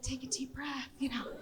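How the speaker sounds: soft, calming reassurance; a quiet Italian friend calming a panicking traveller; soothing. Retold or imitated. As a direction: hush her nerves gently. Daniel calming her.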